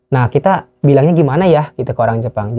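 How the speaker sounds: ordinary delivery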